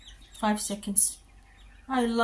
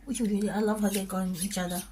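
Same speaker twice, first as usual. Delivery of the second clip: normal talking voice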